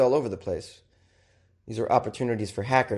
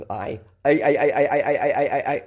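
stuttering "I" like a record skipping, a bit louder; 2 semitones higher, 4 dB louder than usual. Pretend. Joking host imitates a skipping record, stuttering. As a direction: stutter the I like a scratched CD jumping. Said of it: "Remember when records skipped?".

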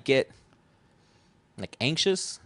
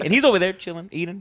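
usual speaking voice

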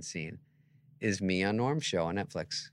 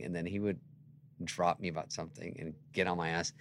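normal talking voice

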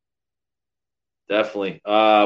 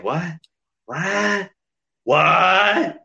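mock disbelief; 3 semitones higher, much slower than usual.

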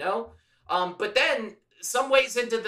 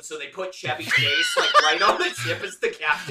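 laughing shouted pitch; 5 semitones lower than usual. Real, not acted. Host pitches Chevy Chase as captain, laughing. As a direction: crack up while pitching it.

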